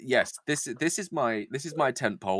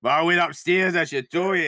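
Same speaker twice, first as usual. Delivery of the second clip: higher, hammed-up rough Scottish; Ramsay Nesbitt; 6 semitones higher, 5 dB louder than usual. [name]'s confession gone awry, hammed-up Scottish accent. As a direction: overdo a drifting Scottish accent. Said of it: "Sounding like Ramsay Nesbitt".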